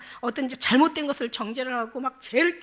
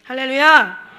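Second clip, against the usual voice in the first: a sudden, much louder praise cry; loud hallelujah shout; 14 dB louder, slower than usual. Real, not acted. Preacher rallying the congregation with hallelujah, loud shout. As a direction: call it out to the whole congregation.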